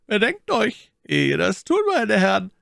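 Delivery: a lightly voiced officer used to giving orders; commanding voice. A narrator's reading. Hidden officer warning the musketeers, lightly voiced command. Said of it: "eine tönende Stimme, die zu befehlen gewohnt".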